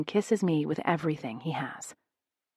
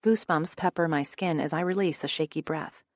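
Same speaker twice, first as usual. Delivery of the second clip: plain reading voice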